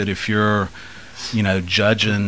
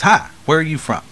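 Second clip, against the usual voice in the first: put-on higher, louder kid asking brightly; little boy voice; 7 semitones higher, 5 dB louder than usual. Telling the cartoon joke, a curious little boy asking.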